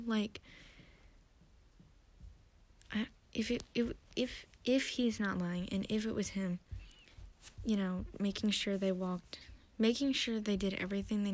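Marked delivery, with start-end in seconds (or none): none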